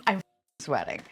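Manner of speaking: ordinary talk, nothing marked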